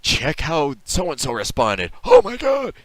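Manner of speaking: loud hype headline building to a high mock gasp of shock; clickbait parody. Host mocking clickbait website headlines, overexcited parody.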